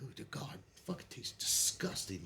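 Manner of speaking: hushed whisper, grimacing through it; a retold whisper of disgust. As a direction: mutter it under the breath as if sick. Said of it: "I'm like".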